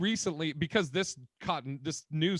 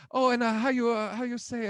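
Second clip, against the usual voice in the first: hammed-up Spanish accent, pitched up; mock Spanish accent; 6 semitones higher than usual. Cooking on TV, she fakes a Spanish accent. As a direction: fake a Spanish accent fumbling for a word. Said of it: "with her thick accent".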